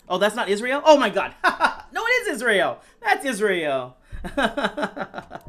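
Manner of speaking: high, loud laughing relief. Real, not acted. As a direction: laugh through the confusion. Confused about opponent's flag.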